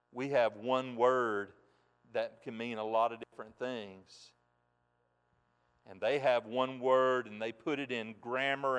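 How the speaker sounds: ordinary talk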